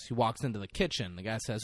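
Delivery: ordinary talk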